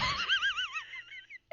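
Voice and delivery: high-pitched squeal of giggling; high giggle. Real, not acted. Laughing fit continues.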